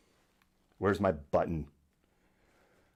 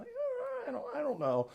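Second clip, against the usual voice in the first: clueless mumble; 5 dB quieter than usual. Retold or imitated. Mocking clueless American abroad.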